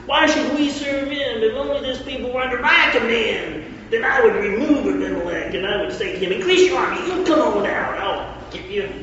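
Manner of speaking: high southern bragging voice; southern accent. Acted. Gaal boasting over drinks, lightly southern, high. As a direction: swagger through it in a southern accent. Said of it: "Notice how I go into southern accent".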